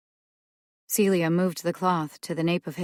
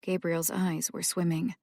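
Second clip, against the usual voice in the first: plain reading voice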